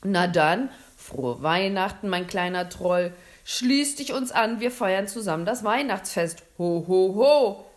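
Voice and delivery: very loud, hearty Santa voice; big festive invitation; jolly Santa, welcoming. A narrator's reading. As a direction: welcome the little guest with booming ho-ho-ho. Santa welcomes the supposed troll.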